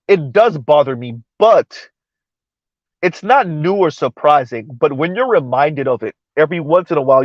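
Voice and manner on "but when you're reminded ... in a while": usual speaking voice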